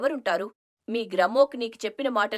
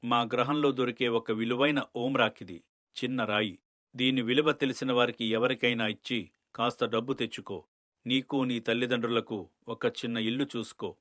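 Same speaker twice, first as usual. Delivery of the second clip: much lower, distant otherworldly tone; deep alien voice; 11 semitones lower than usual. Acted. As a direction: speak like a calm alien elder from far away. Alien elder speaking to Sundaram. (లోతైన గ్రహాంతర స్వరం)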